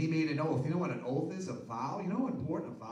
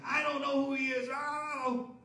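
high, loud hammed-up denial; mock angry denial; 10 semitones higher, 4 dB louder than usual. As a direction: fling the denial like a man caught out. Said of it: "swearing like a drunken sailor".

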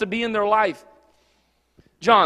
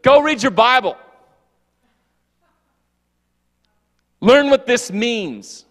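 louder, slow stern scolding; mock yelling; 4 semitones higher, 5 dB louder than usual. Preacher imagining himself telling off his pastor, loud and slow. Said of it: "me yelling at pastor".

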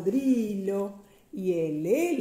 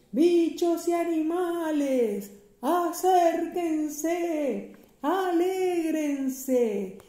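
circus announcer; 6 semitones higher, slower than usual. A narrator's reading. Flea ringmaster invites the animals to the show, slow barker call. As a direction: draw out each word to gather the crowd.

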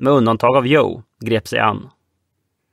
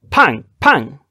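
voiced gunshots; 3 semitones higher, 4 dB louder than usual.

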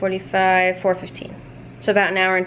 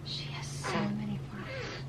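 whispering; 13 dB quieter than usual. Real, not acted. Whispering near sleeping baby.

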